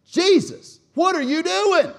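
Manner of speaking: high, very loud scolding shout; harsh rebuke. Acted. Peter pulls Jesus aside, harshly rebuking him. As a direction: scream at him in outrage. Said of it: "This was a harsh rebuke".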